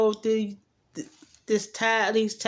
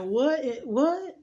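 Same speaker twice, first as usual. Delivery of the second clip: stunned disbelief; 4 semitones higher than usual. Retold or imitated. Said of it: "sitting over there looking like".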